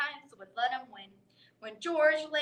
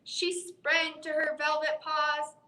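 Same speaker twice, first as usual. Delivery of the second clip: ordinary delivery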